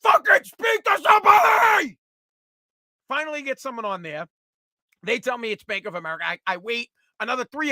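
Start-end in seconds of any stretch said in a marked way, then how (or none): 0.0-2.0 a raging yell, much higher and louder; furious shout. Retold or imitated. Caller fed up with the automated system, screaming. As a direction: scream it at the machine with everything you have. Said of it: "I'm screaming at the automated system".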